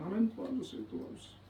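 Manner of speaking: a broken, quiet cry for help; mild weeping prayer trailing into breath; tearful whispered plea. Retold or imitated. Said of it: "그리고 우는 거예요".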